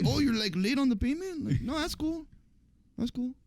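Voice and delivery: high, falsely sweet menace; mock nice. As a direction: sound friendly while threatening. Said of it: "they'll come at you all nicely".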